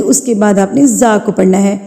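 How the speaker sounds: usual speaking voice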